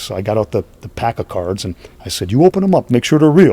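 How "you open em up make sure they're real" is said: loud. Retold or imitated. Handing cards to a fan.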